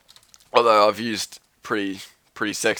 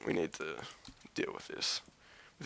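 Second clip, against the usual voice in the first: whispered; 6 semitones lower, 18 dB quieter than usual. Real, not acted. Muttering aside to himself.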